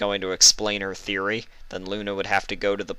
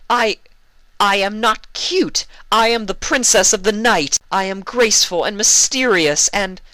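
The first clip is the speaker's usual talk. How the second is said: indignant protest; 14 semitones higher, 7 dB louder than usual. Acted. Luna loudly insisting to her giggling sister she is not cute. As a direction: puff up with wounded royal pride.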